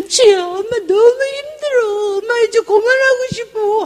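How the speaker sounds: high, slow crying plea to daughter; tearful retelling of a weeping mother; retold crying. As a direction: sob out that you can't go on. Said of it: "하고 막 울고 막 그랬어요".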